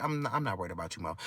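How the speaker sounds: normal talking voice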